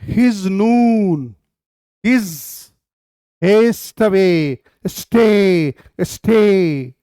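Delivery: HIS, HASTE and STAY drawn out slowly on the s sounds; hissing sound demonstration. Shown as a demonstration. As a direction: linger on each hiss in "his", "haste", "stay". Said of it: "hissing sound". ("his", "haste", "stay" में स की सिसकारी खींचकर)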